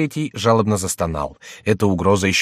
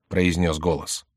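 ordinary delivery, nothing marked

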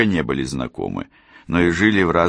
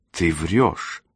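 hoarse accusation with little volume; understated hoarse outburst; hoarse cry. A narrator's reading. Cassie accuses Rosalinda of lying, hoarse mock cry. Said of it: "хрипло крикнула Кэсси".

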